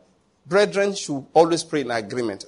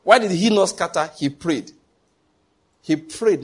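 normal talking voice

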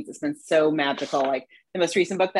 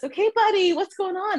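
gentle parent voice; 12 semitones higher than usual. Retold or imitated. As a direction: coax a small child gently. Parent voice to child.

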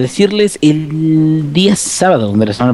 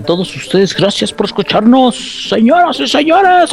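announcer voice; 10 semitones higher than usual. Acted. Greeting listeners in put-on voice.

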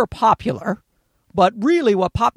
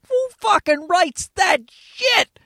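slow, high-pitched incredulous outburst; exasperated disbelief; 10 semitones higher, slower than usual. Real, not acted. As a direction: stretch it out high in disgust. Disgusted at the song lyric.

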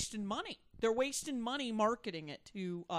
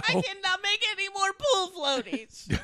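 high, loud hysterical protest; hammed-up screamed outburst; mock screaming; 11 semitones higher, 10 dB louder than usual. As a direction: holler it like someone having a comic breakdown.

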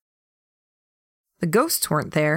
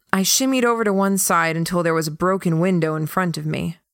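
usual speaking voice

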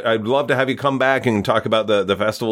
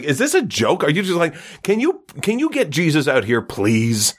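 livid; 7 semitones higher than usual. Retold or imitated. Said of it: "the Pope is just livid now".

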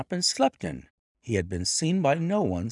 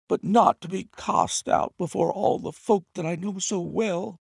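high, strained, desperate servant begging; hoarse pleading butler; 11 semitones higher than usual. A narrator's reading. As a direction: beg for your place, voice cracking with emotion. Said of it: "he cried in a voice which was hoarse with emotion".

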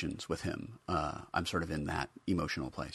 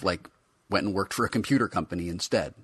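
ordinary delivery, nothing marked

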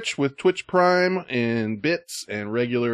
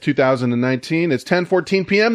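higher, louder show-host announcement; announcer intro; 7 semitones higher, 4 dB louder than usual. Acted. Podcast host opening the episode, upbeat announcer read.

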